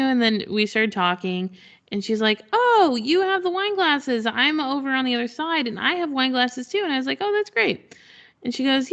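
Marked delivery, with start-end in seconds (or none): 2.5-6.8 chatty potter. Retold or imitated. Fellow potter greets at booth. 7.2-7.9 polite chirp. Retold or imitated. Her own reply to potter.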